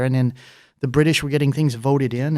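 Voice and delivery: ordinary talk, nothing marked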